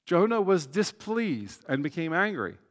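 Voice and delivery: usual speaking voice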